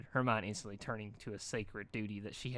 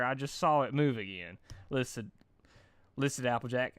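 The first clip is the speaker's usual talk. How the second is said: usual speaking voice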